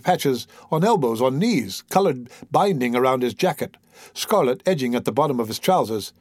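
ordinary delivery

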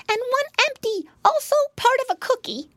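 put-on very high childlike pig voice; squeaky-high storybook pig; little piggy boy. A narrator's reading. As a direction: answer as an earnest little piglet. Piglet answers the mouse.

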